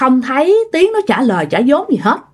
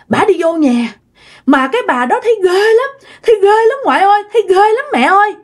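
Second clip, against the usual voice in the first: put-on high, frightened little child telling grandma; child's voice; 5 semitones higher than usual. Frightened child tells grandma and mother about the scary woman, high childish voice. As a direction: speak like a scared five-year-old, pitch up and rising. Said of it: "Cái nó mới nói rằng á là".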